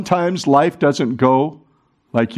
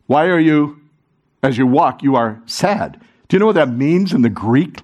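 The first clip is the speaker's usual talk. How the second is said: normal talking voice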